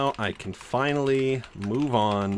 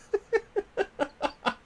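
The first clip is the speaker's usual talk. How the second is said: high incredulous laugh; 6 dB quieter than usual. Real, not acted. Laughs at absurd claim.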